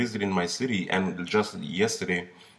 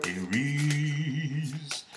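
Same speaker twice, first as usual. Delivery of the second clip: authoritative bass voice; much slower than usual. Shown as a demonstration. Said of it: "Aries doesn't sound good with a small voice".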